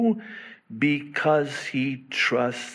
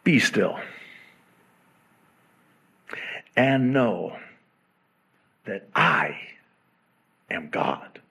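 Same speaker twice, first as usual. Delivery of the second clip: solemn scripture reading; 4 semitones lower than usual. Acted. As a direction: read God's words with gravity.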